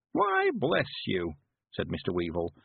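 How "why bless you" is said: put-on high, slow creaky old countryman; old man voice. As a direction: play a cheerful old villager.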